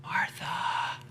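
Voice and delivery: whispered, drawn-out name; hushed calming. Acted. Jesus trying to chill Martha out, hushed whisper. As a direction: whisper it to settle someone down. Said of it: "chill her out".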